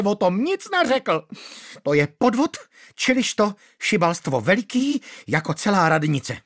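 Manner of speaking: high, shrill, outraged scolding; shrill angry widow. Acted. Manda scolding the magistrate shrilly. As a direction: play the wronged widow screeching.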